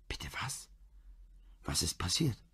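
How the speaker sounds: breathless whispered disbelief; wife whispering in shock at the murder. Acted. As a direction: whisper it, stunned by the news.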